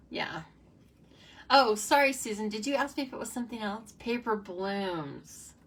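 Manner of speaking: ordinary talk, nothing marked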